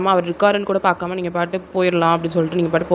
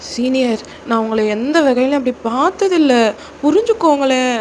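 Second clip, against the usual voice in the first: higher-pitched, earnest plea; pleading junior girl; 6 semitones higher than usual. Acted. Junior girl asking senior to understand her refusal, pleading. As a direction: implore gently as a young junior.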